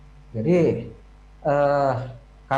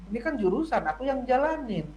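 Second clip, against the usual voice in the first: higher-pitched imitation of a protesting child; mock protest; 7 semitones higher than usual. Child protesting to mother about own major, mock protest. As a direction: argue back as the child, pitch raised.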